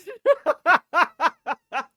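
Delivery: sudden high loud cackle; cracking up at the pervert planet joke; burst of laughter. Real, not acted. Streamer to chat, bursting into loud high laughter.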